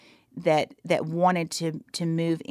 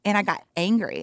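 ordinary talk

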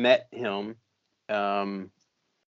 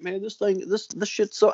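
put-on higher-pitched, tentative Southern accent; 8 semitones higher, 3 dB quieter than usual. Quoting a friend's reaction to camp, light Southern accent. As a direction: start a Southern accent impression, half-hearted. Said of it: "I'm not gonna do the Southern accent".

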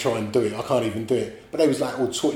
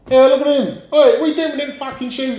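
a mocking youth's voice, much higher and louder, fast; jeering lad; 11 semitones higher, 7 dB louder, faster than usual. Imitating a lad mocking the taped shoes, higher and louder. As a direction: jeer at him like a cocky lad. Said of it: "and one of them went".

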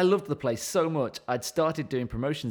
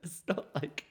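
normal talking voice

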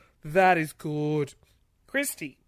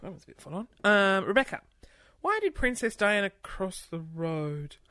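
usual speaking voice

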